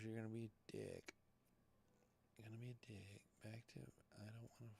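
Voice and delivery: very soft, half-whispered grumble; grumbling under breath. Real, not acted. Host to himself as Reuters won't cooperate, hushed grumble. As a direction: grumble privately, fading into a whisper. Grumbling at a balky news site.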